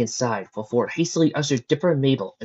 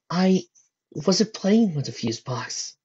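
slow, higher-pitched denial; 8 semitones higher, slower than usual. A narrator's reading. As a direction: say it slowly, drawing it out. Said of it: "he said slowly".

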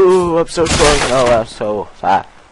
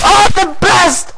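excited gloat; 4 dB louder than usual. Real, not acted. Gloating after a win.

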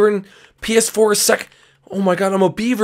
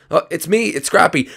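normal talking voice

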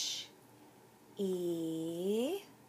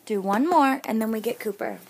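insistent loud; 3 semitones higher, 9 dB louder, much faster than usual. Real, not acted. Insisting to family while filming.

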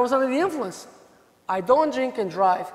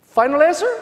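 loud, higher quiz-master ask; game show host; 4 semitones higher, 8 dB louder than usual. Retold or imitated. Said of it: "game show host bit".

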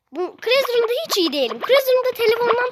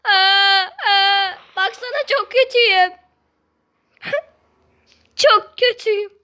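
whiny crying. Real, not acted. Kid losing at game, whimpers.

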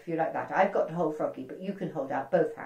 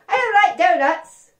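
much higher, louder stubborn no; loud refusal; 11 semitones higher, 10 dB louder than usual. Acted. Froggy rejecting the princess's donut, loudly stubborn. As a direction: whine it like a picky child.

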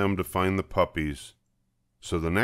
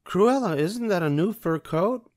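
much higher, light curious voice; higher gentle woman's voice; Anita; 12 semitones higher than usual. A narrator's reading. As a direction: ask it politely, a bit surprised. Anita asks Cruella.

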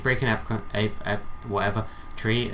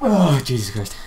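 loud sneeze into words; sneezing; 4 semitones higher, 8 dB louder, slower than usual. Real, not acted. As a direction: sneeze right before speaking. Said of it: "sorry, I just sneezed".

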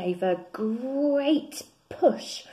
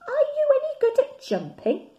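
high, eager little bear; 8 dB louder, faster than usual. Acted. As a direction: ask it in a small high voice. Bear asks frog, high.

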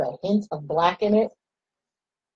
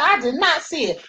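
retold denial; 8 semitones higher than usual. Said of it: "I said".

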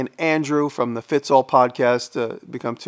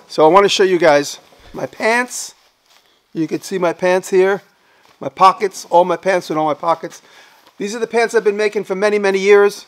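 excited; 7 semitones higher, 4 dB louder than usual. Real, not acted. Excited showing off his pants.

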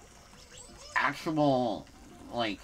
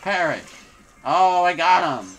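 excited; 5 semitones higher, 10 dB louder than usual. Real, not acted. Gets a kill.

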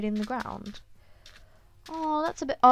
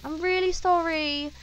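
high, drawn-out apology; apologetic wail; 5 semitones higher, 4 dB louder than usual. Real, not acted. Player apologising to a hunted chicken, apologetic wail. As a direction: plead sorry, slowly and higher.

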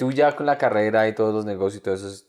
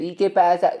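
high-pitched mock Bogotá street thug; exaggerated ñero challenge, pitched up; caricature ñero; 7 semitones higher, 4 dB louder than usual. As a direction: put on an old TV-style ñero voice. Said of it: "el ñero que pinta mucha gente".

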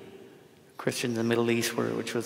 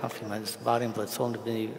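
fast, quieter run-through of a prayer; rapid recitation; 3 dB quieter, faster than usual. Shown as a demonstration. Priest recalling the prayer before he loses it, fast mumbled rush. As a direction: rattle off the memorised words. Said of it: "if I don't say it fast".

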